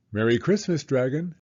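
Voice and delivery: ordinary delivery, nothing marked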